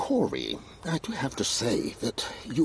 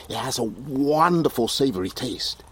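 normal talking voice

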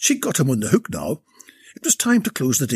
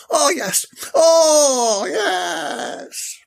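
ecstatic moaning; 13 semitones higher, 3 dB louder, much slower than usual. Acted. Maeve climaxing.

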